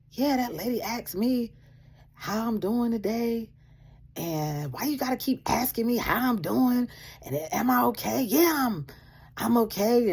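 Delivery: high, snippy retelling of her gripe; imitating a snotty complaint; mocking the rude manager. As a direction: sneer it like the annoyed worker.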